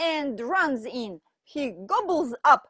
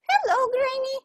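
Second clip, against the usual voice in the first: put-on much higher, louder girl; little girl voice; 14 semitones higher, 5 dB louder, faster than usual. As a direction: chirp it like a small girl.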